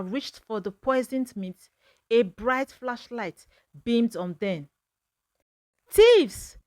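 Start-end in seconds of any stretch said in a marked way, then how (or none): 5.9-6.6 a huge, high roar of accusation. A narrator's reading. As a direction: bellow it out at the intruders. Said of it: "Chief Okonkwo roared".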